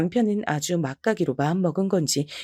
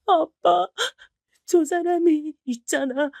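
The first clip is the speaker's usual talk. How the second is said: high, tearful confession; weeping while telling dad; crying; 8 semitones higher than usual. Acted. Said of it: "엉엉 울면서 말을 해버렸답니다". (딸이 아빠에게 울며 털어놓음)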